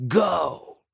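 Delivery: abrupt snake-king order; sharp command. Acted. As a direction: send them away in one burst. Snake king dismisses the warrior.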